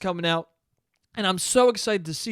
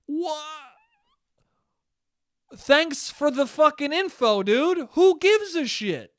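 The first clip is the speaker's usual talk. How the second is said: sarcastic outrage; 8 semitones higher, slower than usual. Real, not acted. Ranting at spoiler now.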